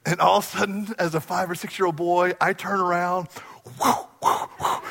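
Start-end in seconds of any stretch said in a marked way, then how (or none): 3.8-4.8 whooshing running sound. Retold or imitated. Imitated running sound effect.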